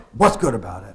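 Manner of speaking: loud, grouchy snap back; grumpy retort. Retold or imitated. Ralph after a bad night snapping at a coworker, loud and grumpy. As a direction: bite back at the cheery greeting.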